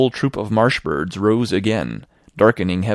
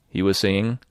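ordinary delivery

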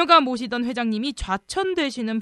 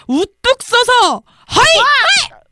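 triumphant shout; 12 semitones higher, 9 dB louder, much slower than usual. Acted. Proud boast in story.

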